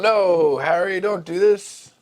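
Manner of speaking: hammed-up desperate cry of no; mock yell. Hagrid begs Harry not to go, in a hammed-up yell. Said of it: "Hagrid yelled".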